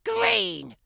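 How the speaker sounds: a high, prim granny calling through the door; old granny voice. Acted. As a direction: call out like a frail grandma.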